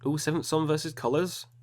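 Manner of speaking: normal talking voice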